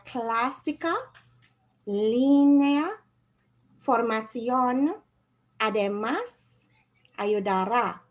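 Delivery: clásica, línea, formación, además, ayudará, each leaning on its accented vowel; stressed accented syllable. Shown as a demonstration. Teacher modelling written accents for students to repeat, accented syllables stressed. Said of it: "that is the syllable that's stressed".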